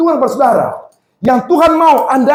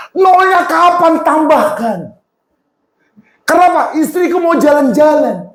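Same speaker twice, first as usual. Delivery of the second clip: high-pitched pleading prayer; re-enacted shouted plea; 6 semitones higher than usual. Husband asks God for money for wife's outing, re-enacted shouted plea. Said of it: "Saya teriak".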